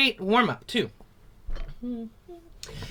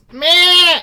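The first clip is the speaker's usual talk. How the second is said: high, loud nasal goat "meh"; a bleat to find the sound at the front of the face; 10 semitones higher, 11 dB louder than usual. Shown as a demonstration. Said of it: "make a goat sound".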